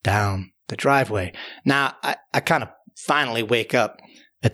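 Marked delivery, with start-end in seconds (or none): none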